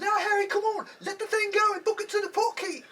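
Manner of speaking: very high, urgent mother's voice; high motherly voice. Acted. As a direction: urge him on like his mom. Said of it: "they was like".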